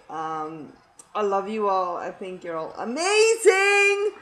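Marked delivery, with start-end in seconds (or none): 2.8-4.1 high, loud, drawn-out gush; gushing praise. Real, not acted. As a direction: stretch it out and soar upward.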